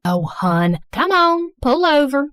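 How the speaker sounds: coaxing Southern mom; thick Southern accent. Acted. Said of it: "speaking in a thick Southern accent".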